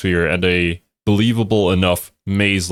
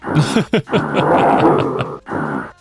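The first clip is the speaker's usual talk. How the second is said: laughing; 4 semitones lower than usual. Real, not acted. Cohost laughs at blunt verdict.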